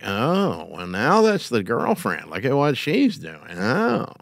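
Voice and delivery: soft mock-impressed drawn-out "oh" with swooping pitch; eye-rolling sarcasm. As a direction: imitate snide staff grumbling about the boss's girlfriend. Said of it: "gonna get the eye roll going, like".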